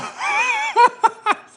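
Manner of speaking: high, loud burst of laughter; helpless high-pitched cackle of laughter; big laugh. Real, not acted. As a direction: crack up loudly at your own joke. Cracks up at own zebra joke.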